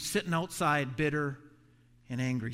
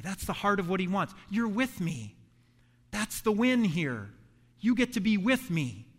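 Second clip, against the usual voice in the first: ordinary talk, nothing marked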